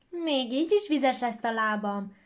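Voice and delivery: loud complaining voice; whiny complaint. Acted. Gingerbread boy on the fox's head, loud whining complaint. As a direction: whine again about the wet feet. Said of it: "folytatta panaszkodást".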